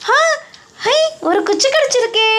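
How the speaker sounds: high, excited little cry of joy; delighted discovery. Acted. Rani finding a stick for her house, excited and high. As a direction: chirp it out pleased.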